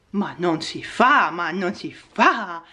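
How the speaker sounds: soft, slow mock scolding of the cat; tut-tut the cat gently. Real, not acted. (rimprovero finto)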